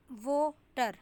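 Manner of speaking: water split into two separate syllables, wa and ter; syllable stress. Shown as a demonstration. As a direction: pause between the syllables of water. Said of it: "मतलब यहाँ पे stress है".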